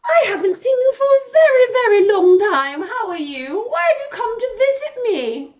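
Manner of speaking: very high, loud and gushing, nearly squealing with joy; a delighted old fairy godmother greeting a dear visitor. Acted. Fairy godmother overjoyed to see Scarlett again, very high and loud. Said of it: "And the fairy godmother said".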